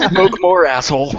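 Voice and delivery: high mock voice. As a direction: bark it in the cartoon voice. Mock advice, Barney voice.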